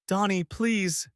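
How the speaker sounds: high, slow pleading plea; pleading wife. A narrator's reading. Wife begging her husband, high and slow. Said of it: "she began".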